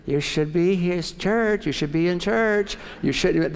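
lightly voiced inner prompting, slow; conscience nagging. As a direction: inner voice about skipping church. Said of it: "some keep saying".

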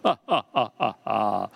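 low mock ha-ha in a deep voice; deep pastor laugh. Preacher recalls Chuck Smith's laugh, deep and imitated. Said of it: "he had that deep voice, and he'd go".

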